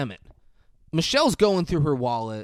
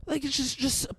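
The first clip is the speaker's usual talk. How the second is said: mock panic; 7 semitones higher than usual. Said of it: "She's panicking and goes".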